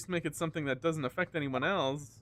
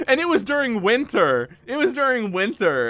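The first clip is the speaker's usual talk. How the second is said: loud, higher, emphatic exclamation; loud exclaiming; 8 semitones higher, 7 dB louder than usual. Real, not acted. Telling how the door came off, loud exclaiming. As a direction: burst out with the awful detail. Storyteller exclaims at worst detail.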